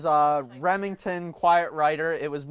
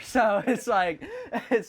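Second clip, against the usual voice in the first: laughing; 6 semitones higher, 3 dB louder than usual. Real, not acted. Laughs at own cell plan.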